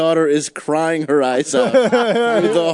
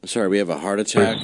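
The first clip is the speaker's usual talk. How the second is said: fast, offhand operator voice; rushed dispatcher; 8 semitones lower, faster than usual. Pretend. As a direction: rattle it off like a bored operator. Mock dispatcher brushing caller off.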